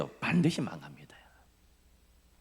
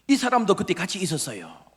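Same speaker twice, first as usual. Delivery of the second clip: higher-pitched pointing-out of a suspect; accusing servant girl; 7 semitones higher, 4 dB louder than usual. Acted. Servant girl exposing Peter by the fire, accusing. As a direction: call him out, voice raised in pitch. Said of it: "한 요정이".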